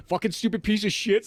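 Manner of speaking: high-pitched retold cursing; angry at camera. Author retelling the photo shoot, annoyed with the camera. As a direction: re-enact cursing at a stubborn gadget. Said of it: "I was getting kinda angry at it".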